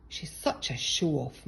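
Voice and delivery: plain reading voice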